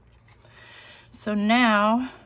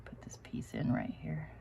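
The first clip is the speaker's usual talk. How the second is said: concentrated whisper; 3 semitones lower, 10 dB quieter than usual. Real, not acted. Crafter fitting a tiny piece, focused whisper. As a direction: whisper while focusing hard.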